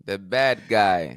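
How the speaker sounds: slow, low villain menace; "The bad guy." drawn out slow and menacing. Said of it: "he already doing the, the bad guy voice".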